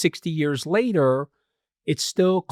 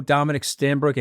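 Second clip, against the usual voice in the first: ordinary delivery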